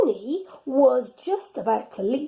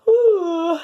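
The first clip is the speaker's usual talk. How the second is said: waking ooh; 8 semitones higher, 6 dB louder than usual. Acted. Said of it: "went Pig".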